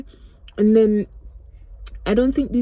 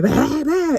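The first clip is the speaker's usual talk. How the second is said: mock baby wail, pitched up; imitating baby crying; 8 semitones higher than usual. Mother mimics her crying baby in the shop, mock wail. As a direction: bleat it like a crying infant. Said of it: "He started crying".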